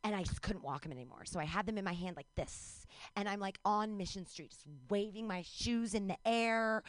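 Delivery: ordinary talk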